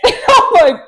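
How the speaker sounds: ordinary talk, nothing marked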